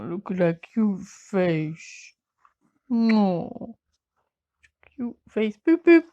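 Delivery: slow, drawn-out fussing over a pet; cooing pet talk. Real, not acted. Owner fussing over the poorly dog, cooing. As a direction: coo adoringly, ending in loud playful boops. Coos over her dog's face.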